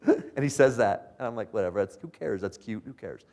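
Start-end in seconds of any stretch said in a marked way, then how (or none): 1.5-3.2 low, soft brush-off; retold offhand indifference; dismissive. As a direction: shrug it off under your breath. Own unimpressed reaction retold.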